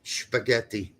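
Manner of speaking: spaghetti said with a German sh, shpaghetti; German pronunciation. Retold or imitated. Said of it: "I love that's the way Germans say sh".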